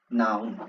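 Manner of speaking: नाउन said slowly and clearly as naun; model pronunciation. Shown as a demonstration. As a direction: say नाउन clearly for the class to repeat. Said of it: "बोलेंगे मेरे साथ". (नाउन का साफ़ उच्चारण)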